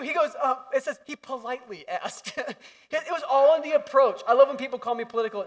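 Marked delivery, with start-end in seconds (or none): none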